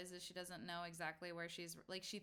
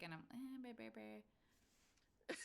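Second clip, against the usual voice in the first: flustered stammer; 8 dB quieter, much slower than usual. Acted. Flustered, can't find words.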